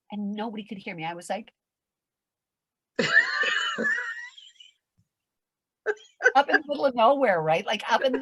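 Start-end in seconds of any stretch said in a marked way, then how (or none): 3.0-4.6 very high long scream; hammed-up scream. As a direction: scream into the void. Screaming alone in the sky.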